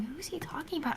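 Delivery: whispered to yourself, puzzled; hushed, wondering who; retold confusion, whispered under the breath. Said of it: "rack my brain going".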